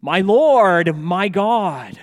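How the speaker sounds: loud, emphatic cry of recognition; retold exclamation of worship. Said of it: "and then he exclaimed".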